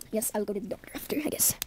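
soft breathy aside; whispering. Acted. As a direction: whisper it under your breath.

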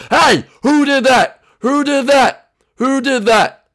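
high, loud demand; angry sergeant. Acted. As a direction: yell at the squad for the culprit. Sergeant demands culprit from recruits.

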